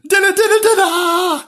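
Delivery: very high, loud dinner call; piercing storybook woman's holler. A narrator's reading. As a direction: sing out as if summoning pets. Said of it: "Gillian calling them".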